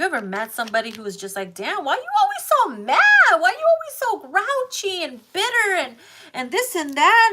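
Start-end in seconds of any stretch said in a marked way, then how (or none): 1.5-7.3 mock exasperated question. Mock-asking someone why always mad.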